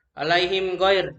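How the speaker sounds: "alaihim ghair" with a distinct m, no blending; clear closed-lip m. Shown as a demonstration. Teacher showing izhar, clear shut-lip m in "alaihim ghair". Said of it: "dengan jelas pada bibir".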